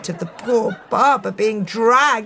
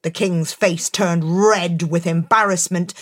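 ordinary delivery, nothing marked

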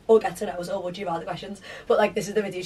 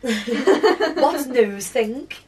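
laughing; 4 semitones higher, 4 dB louder, much slower than usual. Real, not acted. Laughing, reading odd suggestion.

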